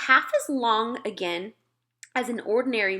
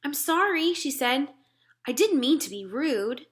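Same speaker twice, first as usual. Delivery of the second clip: put-on higher, puzzled girl's voice; a small girl asking politely; higher child voice; little girl Sophie; 5 semitones higher than usual.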